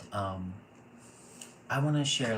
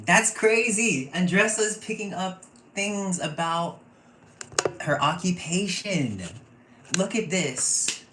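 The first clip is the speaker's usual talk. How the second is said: much higher, loud, slowed exclamation; amazed; 10 semitones higher, 9 dB louder than usual. Real, not acted. Reader amazed the guest sensed the occupation, loud and high. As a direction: marvel out loud at the hit.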